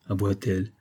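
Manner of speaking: ordinary delivery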